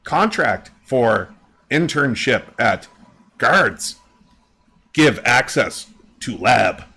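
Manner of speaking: slow halting creature voice; slow, halting bird voice; lightly voiced bird. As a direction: spell out each word like a bird. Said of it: "and he says".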